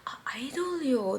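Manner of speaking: slow, very soft whisper; hushed, halting attempt; timid explanation. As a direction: murmur it unsure of the language. Retold timid explanation in Korean.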